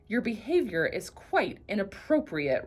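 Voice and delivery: ordinary delivery, nothing marked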